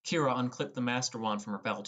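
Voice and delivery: ordinary delivery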